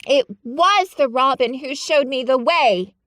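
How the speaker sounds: a hint of a firm girl; lightly voiced Mary. Mary repeats to Ben, firm. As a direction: insist on it.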